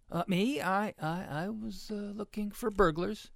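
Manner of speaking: high, halting, caught-out excuse; sheepish stammer. A narrator's reading. Ruby explains her snooping to the pigs, stammering. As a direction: stumble upward through the excuse.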